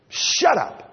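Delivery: mock angry outburst; retold shout. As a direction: snap it like a tense, worn-out dad. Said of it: "hauling off and saying".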